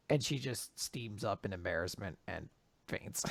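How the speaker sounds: ordinary talk, nothing marked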